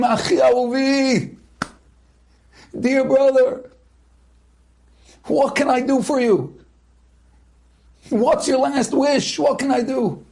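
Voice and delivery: desperate soldier pleading; urgent plea. Retold or imitated. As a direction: beg to help a dying brother. Rushing to dying comrade.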